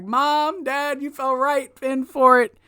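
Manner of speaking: high, taunting imitation; hammed-up triumphant taunt; mock gloating. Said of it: "he's starting to gloat".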